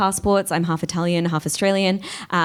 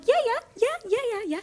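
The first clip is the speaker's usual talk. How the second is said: tiny, very high, soft yeses; eager agreement; 7 dB quieter, faster than usual. Retold or imitated. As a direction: squeak out quick eager yeses quietly. Acting out her own eager replies.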